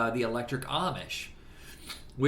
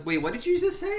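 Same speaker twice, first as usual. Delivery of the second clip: much higher, startled character voice; mock surprise; 13 semitones higher than usual. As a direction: do the double take up high. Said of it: "And he goes".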